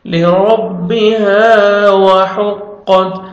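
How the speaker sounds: "لِرَبِّهَا" slowly chanted, final haa stretched; one-second madd. Shown as a demonstration. Teacher showing madd, "لِرَبِّهَا" with drawn-out haa. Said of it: "one second ہا کو آپ نے کھینچنا ہے".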